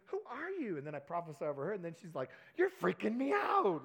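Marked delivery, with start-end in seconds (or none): 0.1-0.8 high, astonished, imitating her; amazed. Daughter amazed at the prophecy, high astonished question. 2.6-3.8 high, loud and slow, half-laughing spook, imitating her. Young daughter spooked by the prophet, loud and high.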